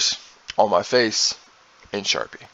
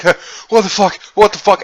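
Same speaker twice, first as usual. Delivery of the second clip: out of breath; 8 semitones higher, 4 dB louder, faster than usual. Retold or imitated. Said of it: "he's out of breath like".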